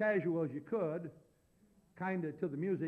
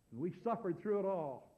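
ordinary talk

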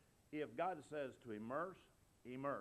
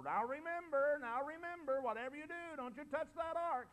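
hammed-up insistent reminder, much higher and louder; mock overemphatic warning; 9 dB louder than usual. Preacher mocks overemphatic warning.

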